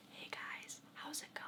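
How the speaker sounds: very soft, voiceless whisper; true whisper. Shown as a demonstration. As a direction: whisper it with no voice at all. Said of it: "you know, you whisper".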